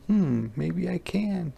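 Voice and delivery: high, slow, pondering cub voice; small high wolf voice, tentative; hesitant wolf cub. Acted. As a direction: think it over warily in a high little voice. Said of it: "And the wolf's like".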